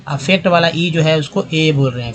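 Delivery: ordinary delivery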